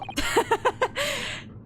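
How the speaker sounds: high, light burst of giggles; real high-pitched laughter; amused laugh. Real, not acted. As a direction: laugh at your own mistake. Laughs at own mistaken guess.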